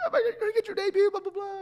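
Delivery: high, fast, gushing wife's squeal; excited squeal. Retold or imitated. Wife squealing over the debut email, excited and fast. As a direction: gush it out breathlessly like your overjoyed wife.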